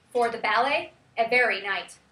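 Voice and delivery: "ballet" as BAL-ay and "every" as e-VER-y; wrong-syllable stress. Shown as a demonstration. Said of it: "wrong emphasis on the wrong syllable".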